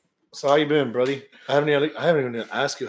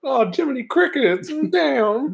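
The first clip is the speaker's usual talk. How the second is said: high mock exclaim; 13 semitones higher than usual.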